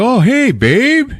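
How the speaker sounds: high, slow, hammed-up sweet talk; gushing greeting. Doctor greets his wife at the door, hammed-up gushing. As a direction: draw out a mock-delighted hello.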